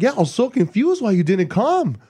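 Kumail accent impression. Comedian quoting his puzzled friend, accent impression. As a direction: put on a high, hard-to-place accent. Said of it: "Kumail's like".

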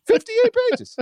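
high, loud disbelief; incredulous. Real, not acted. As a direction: squeak it in disbelief. Incredulous at report length.

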